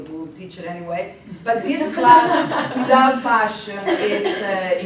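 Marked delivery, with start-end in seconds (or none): 1.4-2.8 a laugh breaking into the words. Real, not acted. Teacher joking to students about passion, laughing. 2.8-4.8 a laugh breaking into the words. Real, not acted. Teacher joking to students about passion, laughing.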